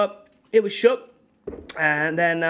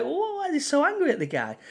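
amazed; 9 semitones higher, faster than usual. Retold or imitated. Retold shocked reaction.

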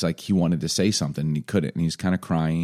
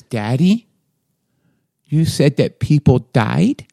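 put-on high, slow little-kid voice; three-year-old asking, high and slow; toddler voice; 6 semitones higher, 5 dB louder, slower than usual. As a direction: voice a small worried child. Dad voices toddler son.

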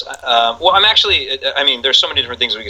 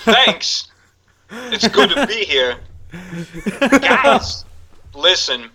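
high, halting Walken voice with odd pauses; Christopher Walken impression; 10 semitones higher, slower than usual. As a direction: do Walken, breaking the phrase oddly. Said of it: "that is spot on".

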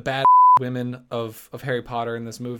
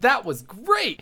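put-on high, eager little boy; excited kid voice; 9 semitones higher, much slower than usual. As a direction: voice a thrilled young boy. Quoting young boy.

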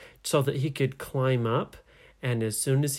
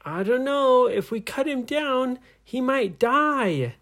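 high, raised voice, last words stretched; storybook hero voicing doubt aloud; worried calling up; 12 semitones higher, 4 dB louder than usual. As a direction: call a hesitant warning up the tree. Heroes call doubts up to pirates.

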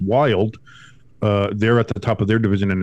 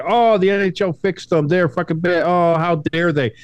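high, whiny mock of the critics; 7 semitones higher than usual. Hockey host mocking fans who cried fix, whiny. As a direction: sneer it like a sore-loser fan. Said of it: "I saw people try to say".

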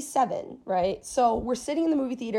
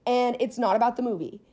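ordinary talk

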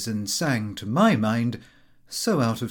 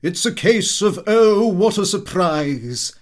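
loud, higher, teasing sing-song; higher, louder, slow mock-mysterious voice; playful Watson; 7 semitones higher, 9 dB louder than usual. A narrator's reading. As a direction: tease them with a drawn-out slow reveal. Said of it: "and he said".

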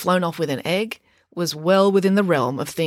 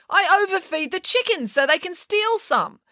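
high, self-justifying protest; indignant; 13 semitones higher than usual. A narrator's reading. Mum listing her kindness to native birds, indignant. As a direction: plead your case in a high voice. Mum defends herself to Tammy.

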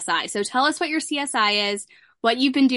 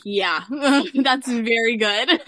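slow, laughing yes through a grin; laughing as she tells her boyfriend that's very good; laughing reply; 3 dB louder, much slower than usual. Retold or imitated. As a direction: drawl it out while laughing. Laughing quote of own reply.

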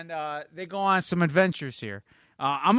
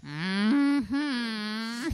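high, drawn-out cartoon hum; squeaky agreeing murmur; Mister Poopy Butthole; 8 semitones higher than usual. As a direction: hum it long and high in character. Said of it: "Mister Poopy Butthole".

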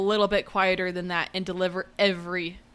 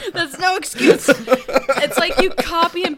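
laughing; 6 semitones higher, 5 dB louder, slower than usual. Real, not acted. Laughing rant.

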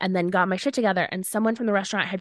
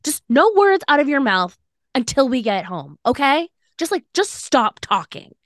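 higher, louder fed-up telling-off; angry outburst; 7 semitones higher, 4 dB louder than usual. Retold or imitated. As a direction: snap at him to shut up, retold with heat. Retold telling boyfriend to shut up.

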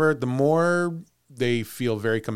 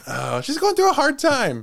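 much higher put-on excusing voice; mock sympathy; 12 semitones higher, 4 dB louder than usual. Resident excusing Ginger from the grocery store, mock sympathy. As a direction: excuse her in a high, make-believe voice. Joins the bit excusing the ghost.

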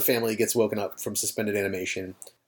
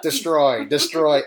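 robot voice; 2 semitones higher, slower than usual. Retold or imitated. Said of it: "the robot is literally yelling".

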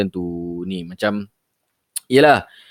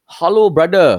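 professional scam caller; 6 semitones higher, 5 dB louder, slower than usual. Retold or imitated. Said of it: "More professional sikit".

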